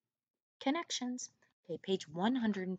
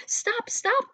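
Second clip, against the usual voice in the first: much higher, louder call of Stop, stop; shouted cry; 7 dB louder than usual. Acted. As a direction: yell after the flying brocade. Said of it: "cried the sons".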